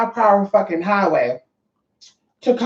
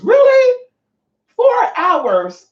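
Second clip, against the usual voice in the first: high, loud incredulous shriek; can't believe it took four hours; outraged disbelief; 5 dB louder, much slower than usual. Real, not acted. Stuck for hours in traffic.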